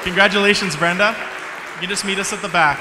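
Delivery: excited congratulations. Real, not acted. Congratulating the winner.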